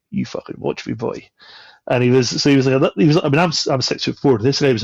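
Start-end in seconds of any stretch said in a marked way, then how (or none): 0.1-1.3 hissed low threat, imitating himself; retold whispered threat to the gopnik in the lift; menacing whisper. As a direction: breathe it out like a quiet warning.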